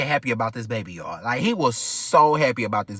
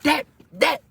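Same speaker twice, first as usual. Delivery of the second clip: going off; 9 semitones higher than usual. Retold or imitated. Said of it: "just going off my apartment".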